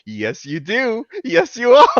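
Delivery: builds to a high, loud comeback; retelling his answer to the no-hug friend, building insistence; rising playful insistence. Retold or imitated. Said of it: "And I was like".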